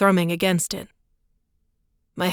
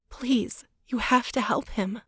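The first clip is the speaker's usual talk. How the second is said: pleading; 5 semitones higher, 5 dB quieter than usual. A narrator's reading. Claire pleading, tearful.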